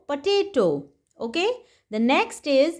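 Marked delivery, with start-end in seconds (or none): none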